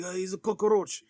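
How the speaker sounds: hammed-up Scarface gangster accent; Al Pacino's Scarface accent. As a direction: imitate the movie gangster's accent. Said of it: "his accent".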